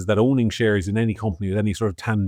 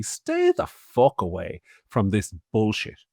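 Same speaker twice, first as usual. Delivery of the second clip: ordinary delivery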